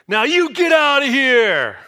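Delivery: high, slow, loud Brooklyn tough guy; slow, loud Brooklyn brush-off; Brooklyn accent. Retold or imitated. Expected Jesus dismissing the woman, Brooklyn accent. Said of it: "like a Brooklyn accent kind of".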